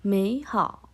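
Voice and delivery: second-tone "Mei" into third-tone "hao"; changed tone. Shown as a demonstration. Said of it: "pelafalannya dirubah menjadi".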